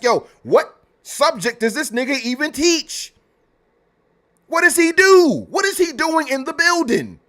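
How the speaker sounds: high-pitched, indignant questioning; exasperated disbelief. Acted. Streamer voicing questions nobody asked the teacher, exasperated. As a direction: demand answers, voice pushed way up.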